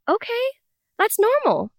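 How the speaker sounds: very high-pitched imitation of mom; accepting mom. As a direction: mom taking news in stride, high voice. Mom's accepting reply, acted out.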